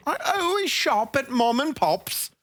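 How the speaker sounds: a clumsy try at British; attempted British accent. Shown as a demonstration. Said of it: "Can you do it with a British accent?".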